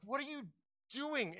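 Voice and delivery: high, incredulous what are you doing; exasperated question. Retold or imitated. Pastor asking his restless dog at night, exasperated and high.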